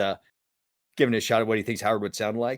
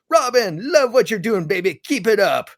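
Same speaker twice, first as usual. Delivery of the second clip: high, gushing, schmoozing Hollywood voice; butter up the star, pitched up and louder; oily director; 7 semitones higher, 4 dB louder than usual. Retold or imitated. Director sucking up to Robin Williams, high gushing schmooze. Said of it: "the director's just like".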